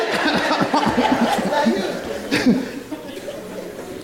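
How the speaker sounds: high, wheezy laugh; lingering laughter. Real, not acted. Preacher after his own joke, lingering laughter. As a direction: laugh on until it peters out.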